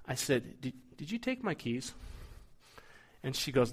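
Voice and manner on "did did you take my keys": soft, sugary question to his wife; careful, honeyed voice asking his wife about the keys; sweetest, kindest voice. Retold or imitated. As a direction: ask as gently as you can, masking suspicion. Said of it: "so I say in the nicest voice, the sweetest".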